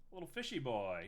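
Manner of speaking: ordinary talk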